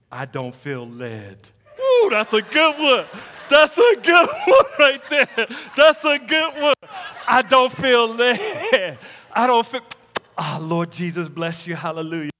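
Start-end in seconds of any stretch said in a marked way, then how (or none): 0.1-1.4 low, slow, hushed mock excuse; mock pious excuse. Preacher imitating a believer dodging service, slow mock pious excuse. 1.8-6.7 high, loud, laughing delight; gleeful hollering. Acted. Hollering delight at his own excuse. 7.3-9.8 loud, high, drawn-out "I don't feel led"; mock excuse shout. Preacher crowing a believer's excuse for dodging service, mock shout.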